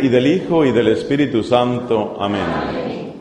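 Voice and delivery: rezo grave y bajo, pausado; oración recitada. Acted. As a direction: recita la oración con recogimiento. Closing prayer and sign of the cross. (el predicador reza a la congregación, en tono de oración)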